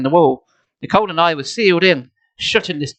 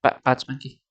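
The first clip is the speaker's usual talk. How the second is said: plain reading voice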